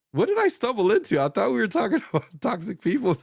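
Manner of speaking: high, loud bewildered viewer voice with a laugh; confused viewer. Retold or imitated. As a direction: play a baffled newcomer who wandered in. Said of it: "They go like".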